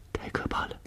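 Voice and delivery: barely audible, horrified aside; frightened whisper. A narrator's reading. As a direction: whisper it to yourself in dread. Frightened inner thought in the cave. (惊恐地悄声自语)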